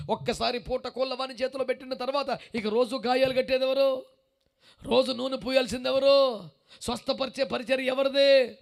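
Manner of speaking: loud, pressing questions at the crowd; demanding sermon questions; forceful preaching. Acted. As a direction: drive the questions home.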